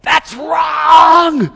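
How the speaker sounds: loud, high shout with a cry. Retold or imitated. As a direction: yell it, pointing at the other driver. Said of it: "I'm pointing at him and I'm going".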